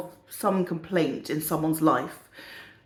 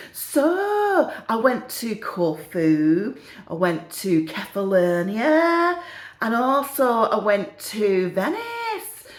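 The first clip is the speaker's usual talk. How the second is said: slow, drawn-out northern English boasting; northern old lady listing holidays, lingering on each; northern English accent; 2 semitones higher, much slower than usual. Acted. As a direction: stretch it out in put-on northern English. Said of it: "have a go at the accents".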